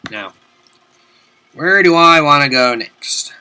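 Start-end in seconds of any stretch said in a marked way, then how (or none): none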